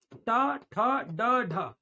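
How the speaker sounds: ट ठ ड ढ with the tongue curled back, crisp; model retroflex sounds. Shown as a demonstration. Teacher introduces the retroflex row: ट ठ ड ढ, crisp. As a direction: drill ट, ठ, ड, ढ with a curled tongue flick. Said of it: "इसके बारे में जरा सीखेंगे".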